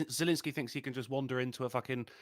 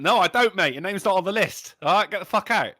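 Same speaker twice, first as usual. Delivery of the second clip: loud, higher bouncer turning him away; bouncer shout; 3 semitones higher, 8 dB louder than usual. Retold or imitated. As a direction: boom it like a doorman. Voicing the bouncer, louder.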